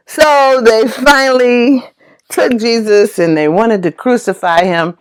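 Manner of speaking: ordinary talk, nothing marked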